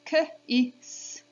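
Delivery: "K, I, S" as separate sounds, kuh, short i, hissed s; sounding out. Shown as a demonstration. Teacher does the sounds of kiss, segmenting "K, I, S". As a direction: break "K, I, S" into phonemes with gaps. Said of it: "Let's do the sounds in the word kiss".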